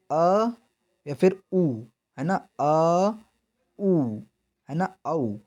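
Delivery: अ and उ held apart, then joined as औ; vowel sound demo. Shown as a demonstration. Teacher breaking a compound vowel into two sounds, demonstrated slowly. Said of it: "इसका जो pronunciation है".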